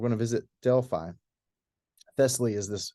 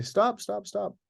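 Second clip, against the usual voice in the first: higher-pitched, restrained call to halt; imitated urgent plea; 9 semitones higher, slower than usual. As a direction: act out stopping someone, without shouting. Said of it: "Paul and Silas say".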